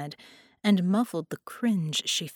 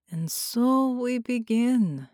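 slow, higher, drawn-out pronouncement; sinister sorcerer; 5 semitones higher, slower than usual. Acted. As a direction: savour the beginning.

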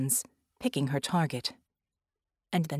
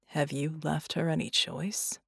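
softer, gentle question; softly asked; 4 semitones lower, 5 dB quieter than usual. A narrator's reading. Red softly asking the woman about Vicky's choice, quiet. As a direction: ask it gently, almost under the breath. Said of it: "Red asked softly".

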